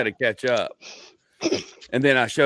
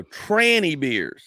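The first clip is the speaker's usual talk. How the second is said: drawn-out emphasis; 5 semitones higher, much slower than usual. Retold or imitated. Comedian repeating his bit to wife, slowly and emphatically. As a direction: stretch both words out for a slow listener.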